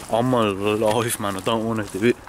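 ordinary talk

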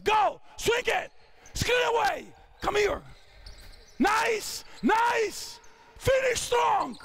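high, ringing coach's calls; calling out. Real, not acted. Coach to players running the play, calling out. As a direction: urge the players on from courtside.

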